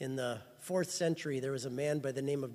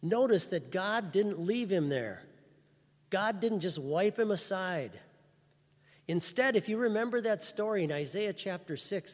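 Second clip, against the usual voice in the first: louder, urgent sermon delivery; full-force preaching; 5 dB louder than usual. Acted. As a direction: preach it out to the whole church.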